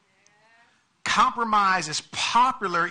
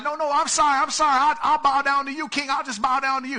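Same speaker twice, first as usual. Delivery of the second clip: higher, louder pleading; mock groveling; 10 semitones higher, 6 dB louder, faster than usual. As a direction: beg the king, in hammed-up panic. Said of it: "he's not".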